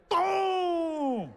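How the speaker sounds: high, loud shouted boom; explosive boom. Retold or imitated. Comic's final punch landing, high loud boom. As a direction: blast it out like an explosion.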